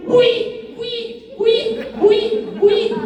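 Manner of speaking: quick high-pitched repeated yelps; silly high chirped yells; mock yelling. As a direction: squeal it over and over in short bursts. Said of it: "and yells".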